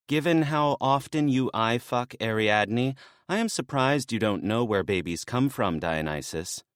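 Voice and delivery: lightly voiced younger woman, teasing; a hint of a sly maenad needling him. Maenad Lalaya teasing Dionysus about Ariadne, lightly voiced.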